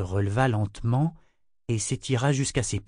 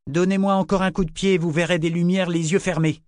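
dry, threatening comeback, pitched up; curt retort; 6 semitones higher than usual. A narrator's reading. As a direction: answer back sharply as a warning. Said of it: "répondit-elle sèchement". (réplique sèche, plus aiguë)

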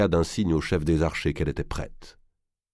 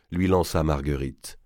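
ordinary delivery, nothing marked

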